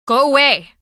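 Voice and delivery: sharp, loud order to go away; angry. Acted. Pub owner ordering Nancy off the ship, loud and angry. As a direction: drive the stranger off the boat. Angry dismissal of intruder on board.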